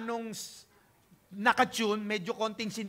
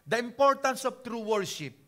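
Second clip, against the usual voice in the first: usual speaking voice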